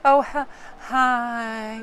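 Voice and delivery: unenthusiastic drawn-out greeting, acted; mock a listless, dragged-out hello; low energy. Partner greeting someone at the door, mock low energy. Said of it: "you're very low energy towards something".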